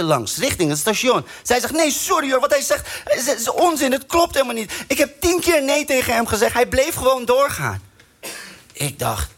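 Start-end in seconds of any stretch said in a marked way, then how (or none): 1.7-7.8 put-on higher, indignant girl; young woman voice. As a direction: object like an upset girl.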